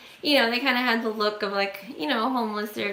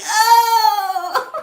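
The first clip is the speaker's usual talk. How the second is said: much higher, loud laughing cry of disgust; laughing shriek; 12 semitones higher, 8 dB louder than usual. Retold or imitated. Woman recoiling as the stranger shows his gums, laughing shriek. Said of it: "I'm like".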